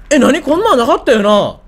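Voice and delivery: startled loud what; sudden surprised outcry; hit hard crying out. Real, not acted. Startled by something new in game.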